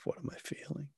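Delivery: breathy whispered self-question; inner whisper. Retold or imitated. The meditator asking himself while walking, whispered. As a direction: ask it to yourself in a hush.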